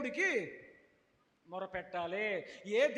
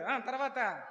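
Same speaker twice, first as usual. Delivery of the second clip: ordinary delivery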